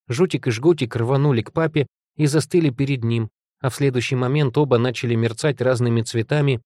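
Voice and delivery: plain reading voice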